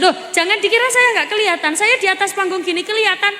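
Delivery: high, fast teasing rebuke; mock scolding. Real, not acted. Preacher teasing sleepy women in the audience, high and quick. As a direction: catch them out playfully.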